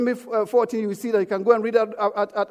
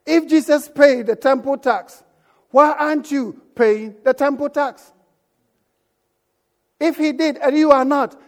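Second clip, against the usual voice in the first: preaching challenge; 4 semitones higher, 5 dB louder than usual. Real, not acted. Preacher repeating his challenge.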